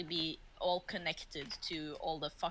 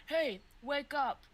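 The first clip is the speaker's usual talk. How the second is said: slap him awake, yelling; shouting to wake him; 4 semitones higher than usual. Acted. Man shouting at the tied orc to wake up.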